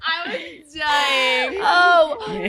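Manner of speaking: laughing hard. Real, not acted.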